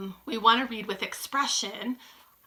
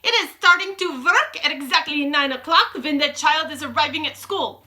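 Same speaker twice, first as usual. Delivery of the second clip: high, raised witch voice; triumphant witch shout; 5 semitones higher, 6 dB louder than usual. A narrator's reading. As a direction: announce it in triumph. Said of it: "shouted the Grand High Witch triumphantly".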